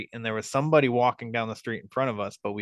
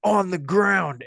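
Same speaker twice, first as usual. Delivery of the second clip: high, drawn-out retold yell, building louder; threatening order; 8 semitones higher, 4 dB louder than usual. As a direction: stretch the command out, swelling at the end. Armed man repeats his order.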